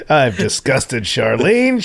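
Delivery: ordinary talk, nothing marked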